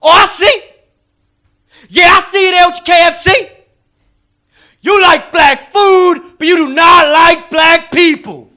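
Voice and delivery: loud high angry shout, slowing down; snapping salesman. Retold or imitated. Comedian acting the salesman's angry loud accusation. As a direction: snap and yell the accusation. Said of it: "he just snapped and he went".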